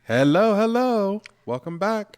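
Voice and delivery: hello sung out high and loud; high excited greeting. Real, not acted. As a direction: call it out like a cheery host. Podcast intro greeting called out.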